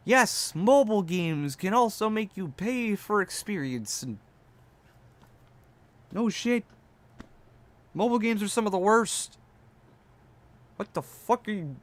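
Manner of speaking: high, mocking retort; mock him, incredulous; sarcastic mockery. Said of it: "playing my reaction up".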